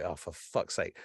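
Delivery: low, soft mock exasperation; exasperated aside. Man overhearing UFO talk in cafe, quietly exasperated. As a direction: grumble it softly to yourself.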